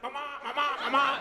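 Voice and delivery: high, rapid-fire "ma" stammer; mocking stutter. Comic roasts the heckler to the club, mock stuttering high. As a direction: exaggerate the stammer on "ma". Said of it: "with the stutter".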